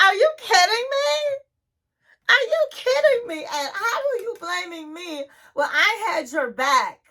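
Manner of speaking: high, squealing disbelief; mock outraged disbelief. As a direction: shriek it like she can't believe him. Liz outraged at Ed.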